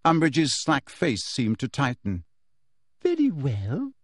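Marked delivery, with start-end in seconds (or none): 3.0-3.9 dangerous sugary menace, a touch higher; sweet on top, threat beneath; falsely sweet. A narrator's reading. Umbridge to Harry, falsely sweet and dangerous. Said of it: "in her most dangerous and falsely sweet voice".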